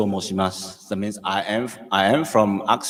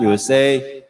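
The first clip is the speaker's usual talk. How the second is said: ordinary talk, nothing marked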